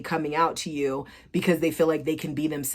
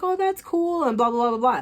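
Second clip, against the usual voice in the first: much higher, airy mock-casual oh; mock dismissive; 8 semitones higher than usual. Imitating the ex brushing off her news, high mock voice. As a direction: toss it off in a high fake-casual voice. Ex's reply mimicked.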